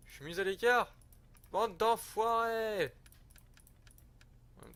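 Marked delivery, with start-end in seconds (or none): 0.1-0.9 louder, higher hammed-up indignation over "écart"; mock offended. Mock offended at being sidelined. 1.5-2.9 much higher and louder, very slow hammed-up insult; mock outrage. Mock insult at other empires.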